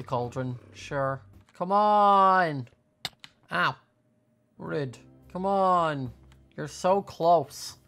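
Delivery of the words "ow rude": normal talking voice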